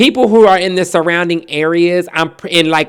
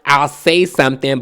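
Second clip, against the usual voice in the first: usual speaking voice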